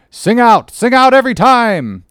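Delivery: high, loud command up the mast; shouted order aloft. Acted. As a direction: bellow it to the masthead. Captain orders lookout aloft.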